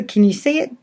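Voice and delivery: plain reading voice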